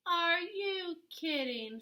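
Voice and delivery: high, slow disbelief; are you serious tone; incredulous. Acted. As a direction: scoff in disbelief. Pig refuses wolf.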